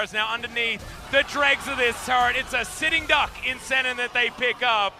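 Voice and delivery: loud, high caster hype through the fight; excited play-by-play. Real, not acted. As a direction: ride the fight at full volume. Hyped teamfight commentary.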